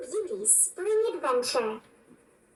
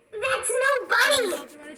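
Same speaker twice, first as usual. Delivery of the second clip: mock shout; 7 semitones higher, slower than usual. A narrator's reading. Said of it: "shouted Flynn".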